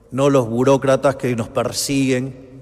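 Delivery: rising to a roaring rally shout; booming balcony speech. Real, not acted. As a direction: build to a thundering crowd cry. Rally speech climax against bureaucrats.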